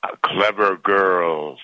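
pleased, unhurried genie. A narrator's reading. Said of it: "said the genie".

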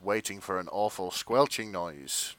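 ordinary talk, nothing marked

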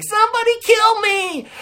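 very high, squeaky cartoon cry; hammed-up falsetto plea; Muffin. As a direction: imitate a tiny cartoon character begging. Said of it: "he was the Muffin".

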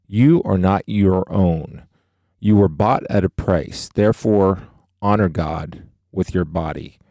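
closing the show with a Bible verse; scripture reading. Acted. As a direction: let each line of the verse land. Closes podcast reading scripture.

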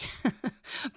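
a quick, high, breathy chuckle at his own joke; short, self-aware chuckle mid-homily; light laugh. Real, not acted. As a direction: laugh off the mixed metaphor. Joke about God as referee.

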